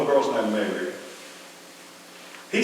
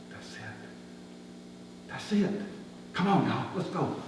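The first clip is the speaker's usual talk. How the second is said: much softer, breathless discovery; softer excited call to come; hushed urgency; 14 dB quieter than usual. Acted. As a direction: urge your friends on under your breath. Spotting the barn at night.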